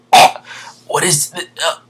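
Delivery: strained last words; dying victim. Acted. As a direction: act out being struck through the heart, cut off.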